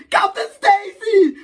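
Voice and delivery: high, loud, drawn-out anguished yell; Spider-Man yelling. Acted. Spider-Man sees Captain Stacy crushed, hammed-up yell. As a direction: cry out in hammed-up horror. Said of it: "Spider-Man just yells".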